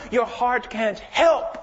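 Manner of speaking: normal talking voice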